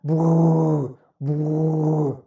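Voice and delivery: slow rolling brrr with closed lips; lip trill. Shown as a demonstration. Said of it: "with closed lips".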